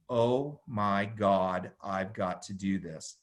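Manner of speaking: drawn out, hushed amazement; slow awe. Retold or imitated. To himself after watching Gracie fights, slow and awed. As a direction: let it sink in slowly, stunned.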